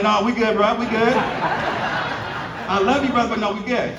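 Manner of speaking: laughing it off, high and bright; chuckling no thanks; laughing refusal. Retold or imitated. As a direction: turn him down through laughter. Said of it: "I'm like".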